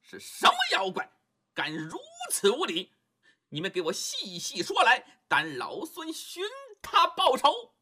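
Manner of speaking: high, furious demand; Monkey King outraged, vowing revenge on the demons; angry Monkey King. Acted. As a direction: rise up in outrage. 孙悟空一听急了.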